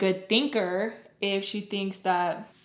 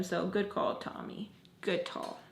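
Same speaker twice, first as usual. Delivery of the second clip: whispered to herself; whispered aside; 8 dB quieter than usual. Real, not acted. Congratulating herself for leaving the car, whispered. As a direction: breathe it like a secret self-pat.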